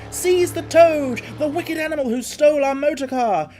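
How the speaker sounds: high, loud, furious demands; angry outcry. Acted. Passengers denouncing Toad, loud and furious. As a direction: rage and call for his arrest. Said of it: "they cried".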